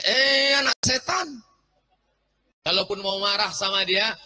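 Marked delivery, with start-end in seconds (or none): 0.0-1.4 mock angry call, high and loud; a mother scolding her child. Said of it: "marah sama anak, lalu manggil dia". (ibu memarahi anak dengan suara tinggi)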